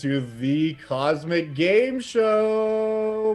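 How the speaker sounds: high, loud announcer with stretched-out words; game show host. Acted. Djinn opening the cosmic game show to a crowd, announcer boom. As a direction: boom out the show's title, drawing it out.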